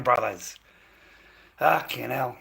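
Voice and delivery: ordinary delivery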